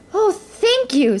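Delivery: high, drawn-out gratitude; slow, high-pitched Cinderella, overjoyed; delighted thanks. A narrator's reading. Cinderella thanks her fairy godmother.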